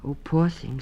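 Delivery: mother mouse pitying her; sympathetic. A narrator's reading. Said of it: "said Missus Mouse".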